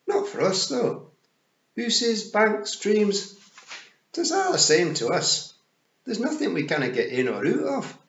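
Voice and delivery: high, loud, boastful Scots pictsie; Rob Anybody. Acted. Rob boasting they get in anywhere, high Scots pictsie voice.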